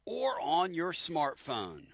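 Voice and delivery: high, loud hyped promo voice; promo announcer. Acted. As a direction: hype it like a trailer.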